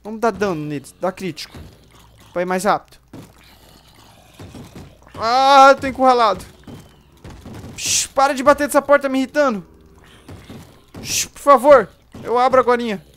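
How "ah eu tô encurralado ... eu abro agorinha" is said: panicked. Real, not acted. Cornered, zombies at door.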